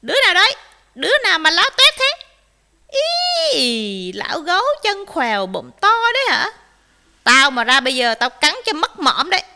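loud, high, threatening fox; fierce screech. A narrator's reading. Fox threatens bear from inside, angry screeching. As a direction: screech back at the intruder. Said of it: "Cáo rít lên".

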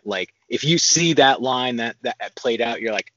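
usual speaking voice

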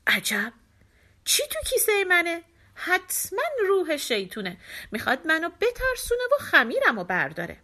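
high, alarmed voice of a startled beggar; frightened beggar. A narrator's reading. Beggar spooked by his sack, high and alarmed. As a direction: gasp it out like he's seen a ghost. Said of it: "مرد فقیر وحشت کرد".

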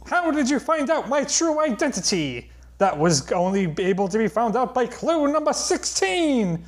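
high, sneering cartoon villain voice; Skeletor. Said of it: "Skeletor? Is that you?".

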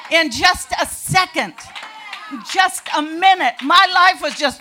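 loud, high, thrilled testimony; excited shout. Real, not acted. Telling a crowd how her life changed in a second, shouting with joy. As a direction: shout it out like the moment just hit. Exclaiming her sudden conversion.

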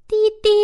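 high, loud tractor beep; two sharp high beeps; horn honk imitation. A narrator's reading. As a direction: imitate a sudden horn blast. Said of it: "喇叭响了".

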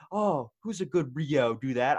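lightly put-on doggy talk. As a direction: imitate cooing at your dog. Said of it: "instead of being like".

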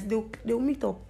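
ordinary talk

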